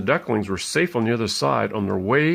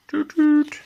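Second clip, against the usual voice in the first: high playful toot-toot of a toy car horn; 14 semitones higher than usual. Retold or imitated. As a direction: toot like a little car for a toddler. Car horns imitated for child.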